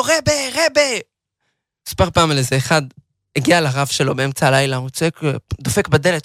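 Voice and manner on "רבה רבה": retold shout. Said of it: "הוא צועק לו".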